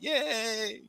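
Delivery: high, small drawn-out yay; mock happy cheer. Kid told he's up next, high mock-happy cheer. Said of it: "he's all happy".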